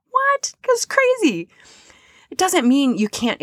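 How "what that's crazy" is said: high-pitched amazed squeal; mock-incredulous gasp of wonder; amazed disbelief. Real, not acted. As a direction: jump way up in pitch, stunned. Own amazed outburst.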